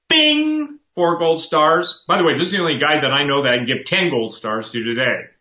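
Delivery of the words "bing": jumps high on a bright ding; high bing. Real, not acted. Host rewarding a viewer's email with a high bing. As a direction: ring it out like a game-show bell. Awarding a caller gold stars.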